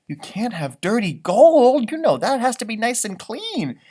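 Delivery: ordinary delivery, nothing marked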